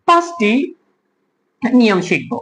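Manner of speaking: ordinary talk, nothing marked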